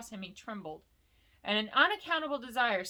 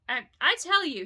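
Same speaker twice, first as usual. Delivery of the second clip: higher, louder, stumbling start; nervous stammer; 7 semitones higher, 5 dB louder than usual. Acted. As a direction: force the words out past fear. Bilbo stammering.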